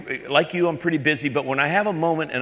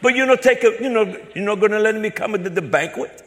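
high, loud, pleading broken English; put-on confused caller, pitched way up; mock-naive broken-English caller; 9 semitones higher, 4 dB louder than usual. Put-on caller feigning hurt to scammer.

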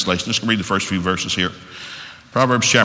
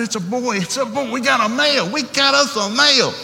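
high, thrilled cry of a new dad; excited; 14 semitones higher, 3 dB louder than usual. Retold or imitated. Dad at the delivery announcing a boy, overjoyed high shout. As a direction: shout it out like you just got a son. Birth of son.

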